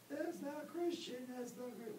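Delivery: high, slow, whining objection; high mocking whine of the banned man; mock whiny protester. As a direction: mimic the offended guy in a high voice. Banned guy protests, mocked.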